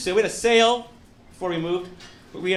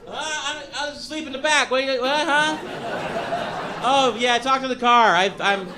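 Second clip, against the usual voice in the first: higher, mock-flustered excuse; just woke up in the back seat; sleepy passenger; 7 semitones higher than usual. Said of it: "I'll be like".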